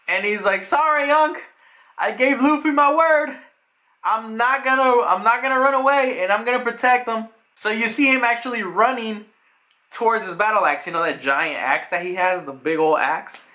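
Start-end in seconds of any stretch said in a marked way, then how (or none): 0.6-7.3 higher-pitched, determined refusal; acted defiance. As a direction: play a loyal fighter standing his ground. Acts out defiant refusal to flee.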